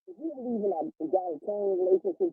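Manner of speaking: muffled, bubbly connection; underwater. Real, not acted. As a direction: sound like you're underwater. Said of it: "You sound like you're underwater".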